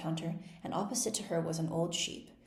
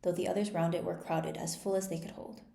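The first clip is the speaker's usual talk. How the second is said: usual speaking voice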